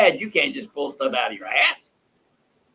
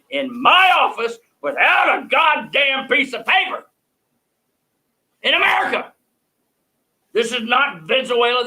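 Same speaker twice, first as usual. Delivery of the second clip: higher, shouting in disbelief; outraged shout; 8 semitones higher, 4 dB louder than usual. Real, not acted. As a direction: cry out at the injustice. Outrage at inspectors' visit.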